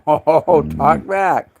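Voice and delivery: amused laughing exclamation. Real, not acted. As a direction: burst out laughing at the pup. Laughing at pup talking back.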